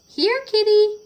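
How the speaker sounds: high, loud call across the garden. Acted. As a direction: call the cat from the back door. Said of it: "she called".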